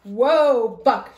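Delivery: loud call to the pony; cried out. Acted. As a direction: shout whoa to calm the horse. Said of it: "she cried".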